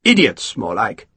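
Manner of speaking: plain reading voice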